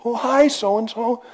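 high, slow, delighted phone greeting; a bright, drawn-out hello to a known caller; surprised recognition. Retold or imitated. Imitating picking up the phone and knowing the caller, high and slow. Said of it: "you hear somebody's voice and you go".

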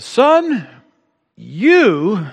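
high, sharp mock mother's call; a hint of a scolding mom; preacher playing his mother.

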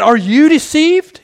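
high, loud indignant demand; accusing Pharisee. Retold or imitated. Pharisees rebuking the officers, loud and indignant. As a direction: throw the charge at them. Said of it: "They said".

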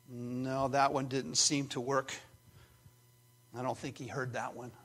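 soft, low mutter to himself; deflated aside. Acted. Psalmist thinks God missed his prayer, deflated low aside. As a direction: drop to a low, doubtful aside.

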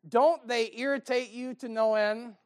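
normal talking voice

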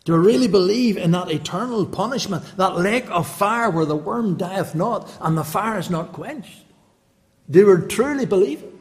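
loud, high revival preaching; full-throated preacher's challenge; fiery preaching. Acted. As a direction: thunder it from the pulpit. Opens sermon at full force.

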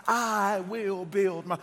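slow and loud, cut off before the end; emphatic call-and-response. Shown as a demonstration. Preacher urges congregation to talk to him, slow and loud. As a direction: belt it out slowly for the crowd to finish. Repeats prompt after talk to me.

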